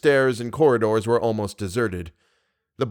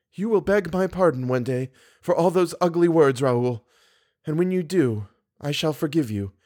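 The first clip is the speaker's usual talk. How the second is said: wounded dignity; 5 semitones higher than usual. Acted. Wronged woman answers his insults.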